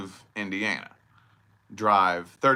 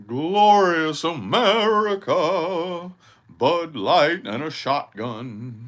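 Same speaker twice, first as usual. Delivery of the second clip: mock patriotic; 6 semitones higher, 7 dB louder, much slower than usual. Mocking redneck pride.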